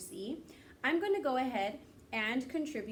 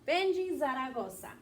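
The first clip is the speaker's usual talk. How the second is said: ordinary talk, nothing marked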